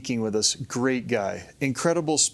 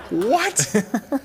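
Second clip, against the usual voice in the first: high, laughing disbelief; shocked laughing what; 9 semitones higher, 3 dB louder than usual. Retold or imitated. Storyteller reliving his shock at the blind kid, laughing. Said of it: "I was like".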